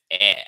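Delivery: "air" as a bare "eh", as in bed; open E sound. Shown as a demonstration. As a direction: punch out "air" loudly as an open "eh". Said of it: "as in bed".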